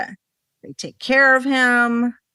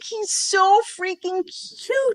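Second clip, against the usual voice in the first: gushing; 11 semitones higher, slower than usual. Real, not acted. Gushing over the puppy.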